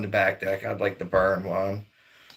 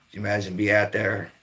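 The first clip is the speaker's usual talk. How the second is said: ordinary talk, nothing marked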